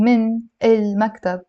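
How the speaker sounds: من المكتب said as "min el-maktab"; separate full words. Shown as a demonstration. Teacher showing the office phrase to replace, words separated. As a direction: keep من apart from المكتب, both vowels clear. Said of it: "instead of saying".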